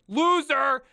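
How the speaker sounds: loud, high mock heckle; mock jeer. Streamer jeers the rejected Facebook applicant, mock shout. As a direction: yell it like a playful taunt.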